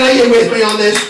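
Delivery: normal talking voice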